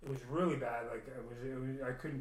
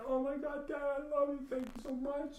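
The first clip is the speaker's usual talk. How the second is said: very high, strained thanks; overwhelmed cry of thanks; 13 semitones higher than usual. Retold or imitated. Son thanking his late dad for a sign, very high and strained. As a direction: call up to the sky in a high, strained voice.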